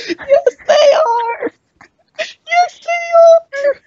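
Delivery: high, loud squeal of laughter; laughing shout. Real, not acted. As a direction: shriek it through laughing.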